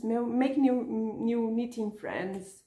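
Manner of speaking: usual speaking voice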